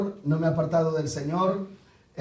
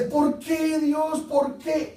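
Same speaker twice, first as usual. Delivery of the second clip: angry plea; 8 semitones higher, much slower than usual. Acted. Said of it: "Y uno dice".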